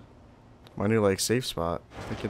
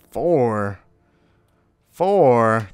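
surprised shout; 3 semitones higher, 12 dB louder than usual. Real, not acted. Checking skill points, shouts in disbelief.

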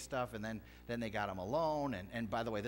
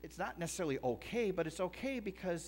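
insistent reply; 8 semitones higher than usual. Retold or imitated. Preacher imitating reply to excuse.

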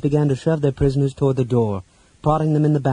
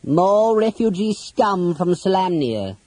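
high, slow villain character voice; Toda; 9 semitones higher, slower than usual. A narrator's reading. Captor remarking on the captured knight, in villain voice. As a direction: sneer it slowly in a high put-on voice. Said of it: "Toda remarked".

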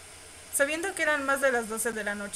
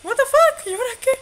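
much higher, much louder startled yell; shocked shout; 13 semitones higher, 13 dB louder, faster than usual. Real, not acted. Player shocked when the date turns into a horror mansion, loud startled yell. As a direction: blurt it out in total shock. Sudden scene change in the game.

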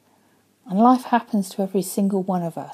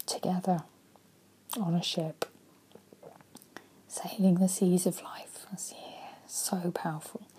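hushed reflection; 8 dB quieter than usual. Real, not acted. Coach to viewers, fading to a hush. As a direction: drop to a near whisper, softer and softer.

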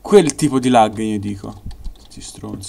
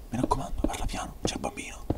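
whispering; 14 dB quieter than usual. Pretend. Hushing again.

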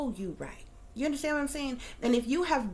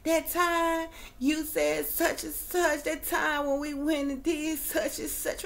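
higher, slow gushing imitation of an old classmate; old classmate gushing about the past, mocked higher and slow; mocking nostalgic acquaintance; 5 semitones higher than usual. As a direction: drawl it out like someone stuck reminiscing. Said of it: "all they got to talk about is the past".

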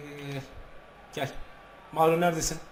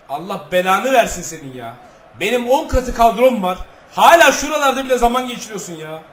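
loud, furious swearing at the screen; angry yelling; 4 semitones higher, 9 dB louder than usual. Real, not acted. Gamer cursing the opponent's player, loud angry outburst. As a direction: rage at the opponent, louder and higher.

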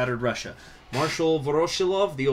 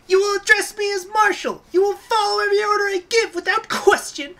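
very high, loud squeaky commander; Katyusha voice; 6 dB louder than usual. Acted. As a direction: lord it over the men up high. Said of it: "Girls in Panzer, Katyusha".

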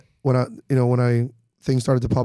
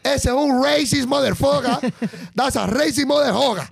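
mock Mexican-accented woman, laughing; 9 semitones higher than usual. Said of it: "the Mexican woman that goes".

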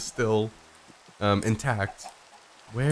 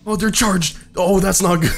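high, loud cry of alarm; alarmed shout; 5 semitones higher, 8 dB louder than usual. Real, not acted. Player spotting charged mobs, alarmed shout. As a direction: call out the danger in a panic.

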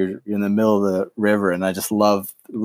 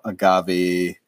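ordinary talk, nothing marked

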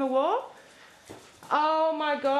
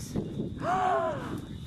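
shocked gasp; 11 semitones higher, 4 dB quieter than usual. Real, not acted. Sees the chewed gumboot.

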